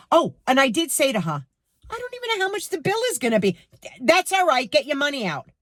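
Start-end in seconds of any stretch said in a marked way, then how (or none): 1.9-3.6 higher, fast protesting reply; flustered protest. Retold or imitated. Her own retort retold. 4.0-5.5 brusque cashier. Retold or imitated. Cashier's rude reply retold.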